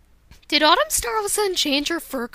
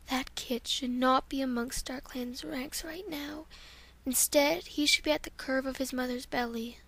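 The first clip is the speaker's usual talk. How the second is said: whispered take of the disapproving line; hushed disapproval; 9 dB quieter than usual. Acted. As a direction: hush it so the kit won't hear.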